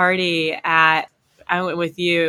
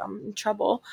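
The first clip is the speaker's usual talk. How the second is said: softer worried murmur to herself; a hint of panic, kept much softer; worried hush; 8 dB quieter than usual. Thinking she's caught by the officer, hushed worry. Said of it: "I was like".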